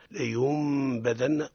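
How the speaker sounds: "لينبذن" slowly, its ن sounded as a held m; noon read as meem. Shown as a demonstration. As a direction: hum a long m before the b in "لينبذن". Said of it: "تقلب ميما خالصة". (نطق "لينبذن" بميم مغنونة بدل النون)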